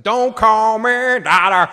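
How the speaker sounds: mock defiant Burnham. Host acts out Burnham refusing the Emperor, slow and defiant. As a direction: spit it out slowly like a stubborn daughter.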